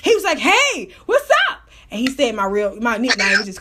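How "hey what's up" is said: high, rising imitation of a friendly hail; retold greeting. Bar worker greeting her on his night off, high upbeat hail. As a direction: call out a mock hello, pitch climbing.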